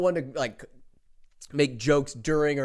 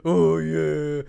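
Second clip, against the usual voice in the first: mock moaning. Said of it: "the moaning and groaning".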